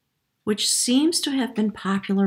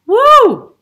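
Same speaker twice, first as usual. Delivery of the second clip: laughing whoop, much higher and louder; thrilled whoop; 15 dB louder than usual. Real, not acted. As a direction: let out a delighted woo at the card. Thrilled at the card drawn.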